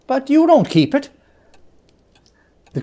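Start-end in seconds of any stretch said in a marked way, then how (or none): 0.1-1.1 put-on high, bright young voice; cheerful nephew. Nephew teasing his uncle, high and bright. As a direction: playfully point it out.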